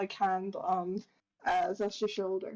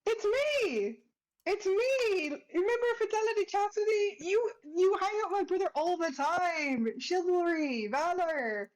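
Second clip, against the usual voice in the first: eager reintroduction; 8 semitones higher than usual. Acted. Fides reminding Shadow of her family, high and eager. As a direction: bounce with joy, remind them who you are.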